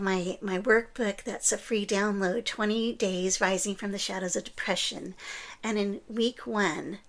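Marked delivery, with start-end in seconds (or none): none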